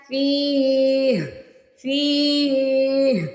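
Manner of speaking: फ़ीह with the ई held long and slow; long stretched vowel. Shown as a demonstration. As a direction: draw out the ई in फ़ीह like a recitation. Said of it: "या तो टू second खींचेंगे". (फ़ीह में ई को लंबा खींचना)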